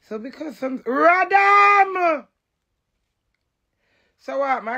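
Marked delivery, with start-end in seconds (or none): 0.8-2.3 loud high outburst; yelled exclamation; shout. Real, not acted. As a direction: blast it out suddenly. Sudden outburst.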